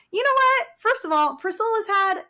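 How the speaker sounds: high, loud mock-indignant retort; indignant comeback. Mom defending herself for crying, retold indignant comeback. Retelling her comeback to being teased.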